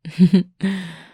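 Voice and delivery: a full, hearty laugh at them; loud laugh. Real, not acted. Teasing partner laughs loudly.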